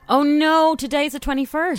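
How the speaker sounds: surprised realisation. Real, not acted. Realising the mix-up.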